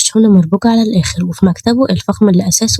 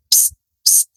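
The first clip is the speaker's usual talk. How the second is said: unvoiced, hushed "psst" call; hissed psst; 5 dB quieter than usual. Kidnapper calls a girl over from his car, hushed psst. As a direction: get her attention without a sound.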